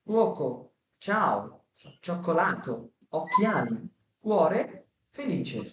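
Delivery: slow, soft Italian word list; model Italian pronunciation. Shown as a demonstration. Teacher reading the Italian word list to students, clearly. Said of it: "দেখেন, নিচে আবার আছে".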